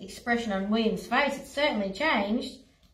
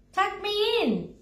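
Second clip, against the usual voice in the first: whiny lion; 11 semitones higher, slower than usual. Acted. Lion stalling.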